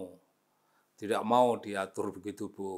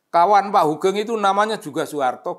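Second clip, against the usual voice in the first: excited; 9 semitones higher, 9 dB louder, faster than usual. Real, not acted. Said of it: "excited storytelling burst".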